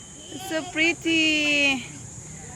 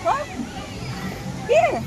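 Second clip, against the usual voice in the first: much higher, bright call of here; playful peekaboo; 10 semitones higher, 3 dB quieter than usual. Real, not acted. Mother playing peekaboo with her baby, high excited call.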